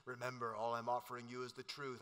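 low, soft Morpheus voice; Lawrence Fishburne impression. Preacher voicing Morpheus to Neo, low and soft. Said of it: "Lawrence Fishburne impression".

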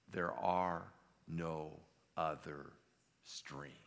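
slow, word-by-word, sinking at the end; solemn slow pronouncement. Acted. Aslan answering Jill about another stream, slow and solemn.

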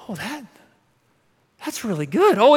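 ordinary talk, nothing marked

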